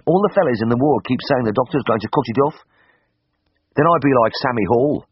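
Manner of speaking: broad Birmingham lad fretting; Birmingham accent. A narrator's reading. Patient repeats the ward's teasing to the vet, worried Birmingham voice.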